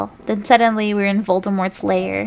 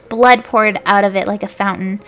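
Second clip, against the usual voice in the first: ordinary delivery, nothing marked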